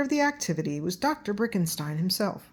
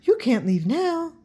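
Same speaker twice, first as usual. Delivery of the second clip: high, louder owl voice; 9 semitones higher, 4 dB louder than usual. A narrator's reading. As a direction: warn them off, owl-like. Owl blocks the kids.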